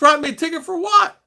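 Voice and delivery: high, loud indignant question. Retold or imitated. Driver challenging a policeman over a ticket, indignant. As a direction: protest in disbelief, voice jumping up. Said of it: "then I started getting angry".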